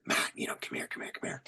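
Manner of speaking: very soft hushed call; whispering. Retold or imitated. Officer whispering to his sergeant in pitch dark woods. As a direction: call him over in a whisper. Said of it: "I'm like".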